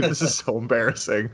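usual speaking voice

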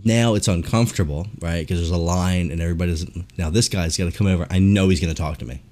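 normal talking voice

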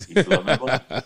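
chuckling through the slogan; laughing. Real, not acted. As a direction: laugh while saying it.

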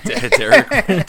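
high laughing stammer. Real, not acted. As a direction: laugh through the name. Laughing repeat of name.

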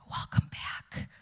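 soft whispered welcome; hushed and tender; gentle whisper. Retold or imitated. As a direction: whisper it kindly, like a quiet homecoming. God speaking gently to her.

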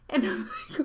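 ordinary talk

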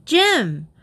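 high, alarmed call; frantic call. A narrator's reading. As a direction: call out to a fallen friend. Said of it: "Trixie called frantically".

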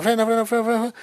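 high, urgent mock yell of frena; retold yelling. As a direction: imitate a friend yelling frena, frena. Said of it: "ese vato me gritaba". (grito imitado y agudo de frena)

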